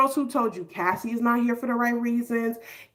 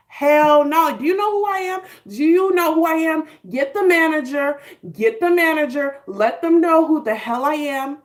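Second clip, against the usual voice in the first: higher, louder entitled demand; hammed-up diva tantrum; diva outrage; 5 semitones higher, 5 dB louder than usual. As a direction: loudly insist on the manager like a spoiled star. Mock diva demanding the manager.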